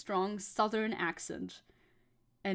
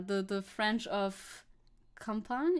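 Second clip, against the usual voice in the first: usual speaking voice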